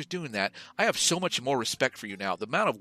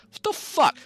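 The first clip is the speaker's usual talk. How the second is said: exasperated outburst; 4 dB louder than usual. Real, not acted. Ranting about band name.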